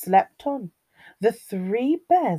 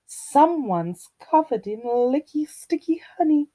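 higher mother bear voice, hushing at the end; tutting Mummy Bear; 6 semitones higher than usual. A narrator's reading. Mummy Bear sees sticky Goldilocks, tutting voice. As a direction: tut it as mama bear. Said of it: "tutted Mummy Bear".

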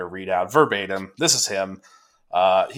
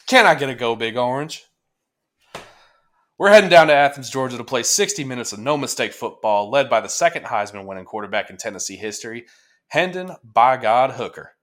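louder, pumped-up fan speech read out; hype read; 3 semitones higher, 4 dB louder than usual. Acted. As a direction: read it like a rowdy pep rally. Reads Toomer's hype piece aloud.

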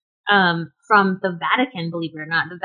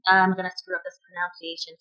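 ordinary delivery

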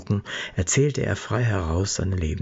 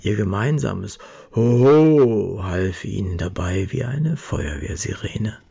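usual speaking voice

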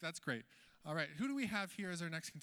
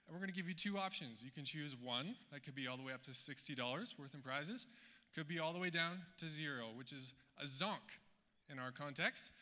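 ordinary delivery

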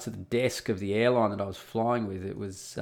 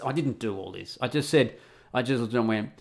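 usual speaking voice

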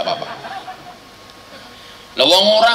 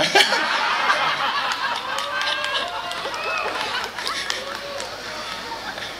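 crowd laughter; 8 dB quieter than usual. Real, not acted.

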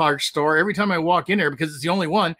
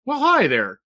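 bright casual greeting; 5 semitones higher, slower than usual. Pretend. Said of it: "How am I gonna say it?".

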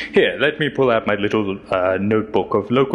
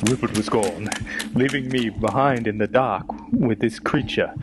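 ordinary delivery

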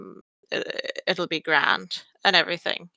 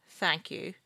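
ordinary delivery

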